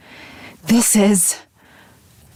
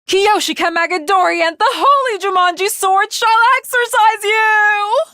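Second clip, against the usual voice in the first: battle cry; 10 semitones higher than usual. Acted.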